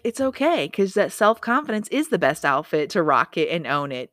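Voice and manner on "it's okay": airy it's-fine toss-off; breezy reassurance. Pretend. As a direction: shrug it off, louder and higher. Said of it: "you're just like".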